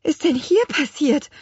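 high, shocked quaver; trembling voice. A narrator's reading. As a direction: ask it shakily, aghast. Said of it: "fragte sie mit bebender Stimme". (mit bebender Stimme gefragt)

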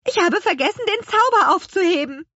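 high, loud, agitated confession; alarmed exclamation. A narrator's reading. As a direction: cry it out in dismay. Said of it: "rief Petronella".

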